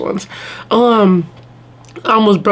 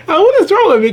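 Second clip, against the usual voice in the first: laughing; 11 semitones higher, faster than usual. Real, not acted. Laughing fit over sister's story.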